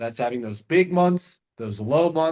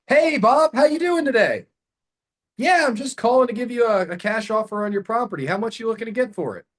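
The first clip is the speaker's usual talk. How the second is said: much higher and louder, hyped-up sales pitch; pushy salesperson; 10 semitones higher, 4 dB louder than usual. Shown as a demonstration. Cold-calling Bob with a loud salesy pitch. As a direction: oversell it like a cold-caller. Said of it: "The seller's gonna realize it's a salesperson".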